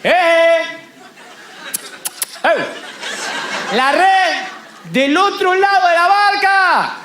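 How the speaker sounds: insistent shout. Acted. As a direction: bellow it out to the boat. Lord insists, shouting from shore.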